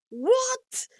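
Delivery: high, loud mock-startled yelp; shocked reaction. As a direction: shoot the pitch way up in disbelief. Startled reply in improv banter.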